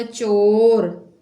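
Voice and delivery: धीमा, थोड़ा ऊँचा "चोर"; चोर को खींचकर और ज़ोर से; 1 stretched word. Shown as a demonstration. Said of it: "चा ओ चोर". (अक्षर जोड़कर धीमे बोलना)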